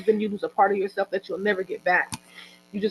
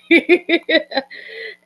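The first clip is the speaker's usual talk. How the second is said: high, loud sheepish giggle; embarrassed laugh; 12 semitones higher, 7 dB louder than usual. Real, not acted. Speaker laughs at her own remark to the volunteer sisters. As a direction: laugh it off warmly.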